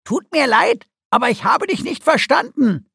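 high, loud cartoon creature voice; pointed, high-pitched prodding; demanding creature. A narrator's reading. Guki demands a louder answer.

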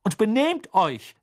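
high, loud admonition; calling after them. A narrator's reading. Mother calls after the sulking twins, loud high warning. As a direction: call a warning after departing children. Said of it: "rief sie den Zwillingen nach".

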